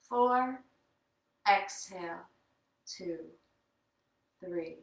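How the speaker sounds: slow counted breathing cues; yoga breath count. Acted. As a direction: guide the breath count calmly.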